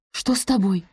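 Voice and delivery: ordinary delivery, nothing marked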